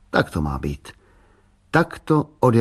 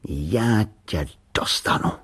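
slow, menacing threat; drawn-out boyish menace; dangerous voice; slower than usual. A narrator's reading. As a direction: threaten it slowly, word by word. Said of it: "řekl nebezpečným hlasem".

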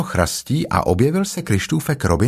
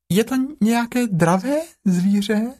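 much higher, small timid Piglet; little Piglet voice; 12 semitones higher than usual. A narrator's reading. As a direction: squeak it like a tiny pig. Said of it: "zeptalo se prasátko". (vysoký ustrašený hlásek prasátka)

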